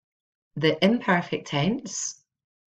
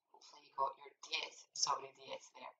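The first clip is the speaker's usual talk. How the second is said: whisper; 2 semitones lower, 18 dB quieter than usual. Acted. Teacher aside after the quiz.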